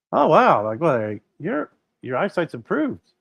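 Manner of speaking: high-pitched, wowed imitation; mock amazement. Eye doctors see improved eyesight, mock amazement. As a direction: imitate pleasantly astonished examiners.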